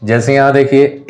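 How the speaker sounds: usual speaking voice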